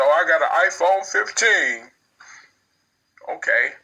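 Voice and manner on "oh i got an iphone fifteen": high-pitched mocking brag; mock excited bragging. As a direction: imitate someone showing off a new gadget. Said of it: "you excited about a phone".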